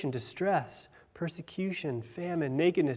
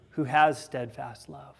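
usual speaking voice

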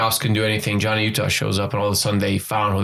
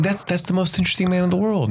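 starstruck; 8 semitones higher than usual. Retold or imitated. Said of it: "I was like".

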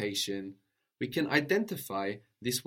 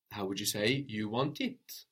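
ordinary delivery

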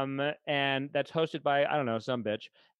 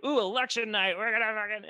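excited; 6 semitones higher than usual. Retold or imitated. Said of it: "that used to be something I was looking forward to".

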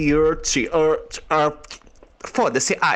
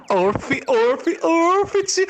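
climbing yelps; excited barks rising high; rising excited grunts; 11 semitones higher, much slower than usual. Acted.

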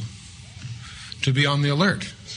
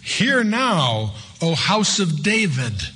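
higher, slow proclaiming of the verse; solemn divine call; 5 semitones higher, much slower than usual. Acted. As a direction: call it out as the voice of God.

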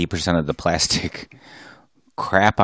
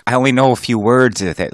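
ordinary talk, nothing marked

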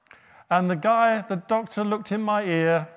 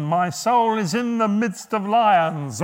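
dramatic reading; 4 semitones higher, 7 dB louder, slower than usual. Acted. Preacher reads psalm dramatically.

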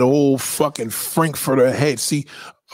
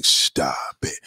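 a breathy whispered "stop it"; 12 semitones lower, 4 dB quieter than usual. Real, not acted. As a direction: drop to a hushed aside. Whispered aside to a friend.

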